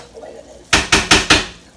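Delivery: slow, voiceless exclamation; stunned amazement. Real, not acted. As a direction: draw it out in a forceful stage whisper. Awed at the cooked food.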